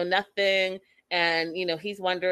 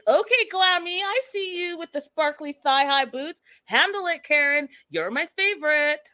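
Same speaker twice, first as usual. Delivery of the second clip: playful hype; 8 semitones higher than usual. Retold or imitated. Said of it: "I was like".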